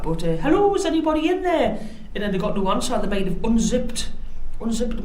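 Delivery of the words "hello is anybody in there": second mock intruder call. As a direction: try the stranger's call a second way. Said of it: "They probably wouldn't have talked like that".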